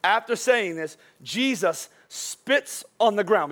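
very slow, each word weighed; slow, deliberate reading of the verse; read slowly. Shown as a demonstration. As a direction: draw out every word so the scene sinks in. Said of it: "I want you to read this slowly".

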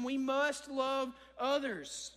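"must" drawn out slowly for emphasis; slow down and press on "must"; stress on MUST. Real, not acted. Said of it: "Emphasis on the word must".

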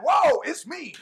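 spring it up with joy; joyful exclaim. Shown as a demonstration. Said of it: "now I could be like".

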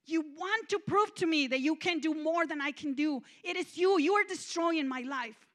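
loud, high furious yelling at God; angry accusation. Retold or imitated. Shouting at God, so angry she didn't blink, furious and loud. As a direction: throw every charge at him. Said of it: "but I was so angry".

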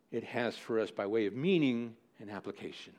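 ordinary delivery

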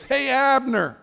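high, loud call across the valley; David hailing Abner from the hill, loud call. Acted. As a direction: holler it down the hillside. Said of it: "he cries out in this voice".

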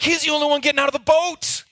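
put-on high, loud and fast, like an eager child; eager kid. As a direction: burst out wanting to be Peter. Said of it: "I'm like".

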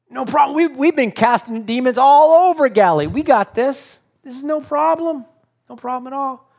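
high, loud, hammed-up overconfidence; cocky boasting. Nine disciples assure a begging dad they've got this, cocky.

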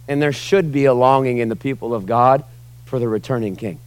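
ordinary talk, nothing marked

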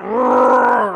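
loud, high growl of outrage; mock rage growl. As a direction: growl in hammed-up fury. Mock rage noise after outburst.

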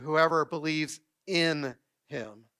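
"in" drawn out and set apart by a pause; slow phrase with a weighty "in"; stress on in. Shown as a demonstration. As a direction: lean on "in" slowly, then pause before "him". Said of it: "I emphasize that word "in".

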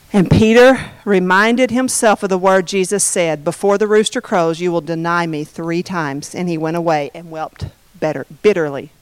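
low, hushed, sorrowful reading; somber narration. A narrator's reading. Preacher reading of Peter weeping bitterly, somber and hushed. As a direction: let the voice sink and soften toward the end.

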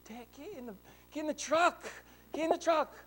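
high, pressing call to hurry dad; impatient kid's plea; urgent plea. Retold or imitated. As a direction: urge him into the truck. Child urging dad to hurry.

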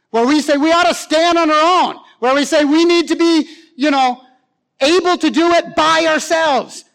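high, loud, punchy pulpit delivery; forceful preaching. Real, not acted. Preacher challenging the congregation's self-reliance, forceful preaching. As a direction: preach it at full force, hammering each phrase.

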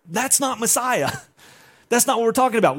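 high-pitched disbelief; acted protest. Disciples hearing about death for the first time, protesting. Disciples reject the shocking news.

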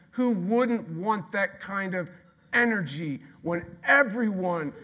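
high, loud, slowing toward the end; loud preaching build. Real, not acted. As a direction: drive it home loudly, then stretch it. Preacher building full-force appeal.

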